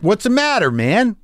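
high-pitched indignant challenge; acting out an annoyed confrontation; retold confrontation. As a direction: call him out, voice jumping up. Confronting comic who snubbed him.

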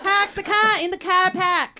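slow, broad Boston-accent impression; hammed-up New England accent; John F. Kennedy. Shown as a demonstration. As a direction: do a drawn-out presidential Boston accent. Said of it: "John F. Kennedy".

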